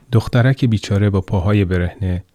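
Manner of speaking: ordinary delivery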